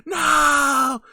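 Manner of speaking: high, loud, stretched-out yell; hammed-up scream of defeat; mock villain cry. Bill's cry as he loses.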